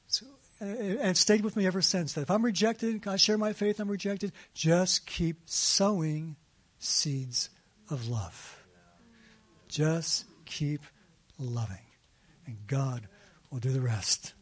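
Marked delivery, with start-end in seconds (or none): 4.5-14.3 earnest lesson. Real, not acted. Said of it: "preaching the lesson".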